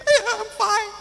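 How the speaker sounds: high, teary voice forcing "I'm fine"; choked-up reply. Retold or imitated. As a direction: insist you're fine through tears. Choked up, answering the clerk.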